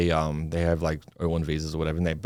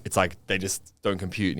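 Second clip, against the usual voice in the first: ordinary talk, nothing marked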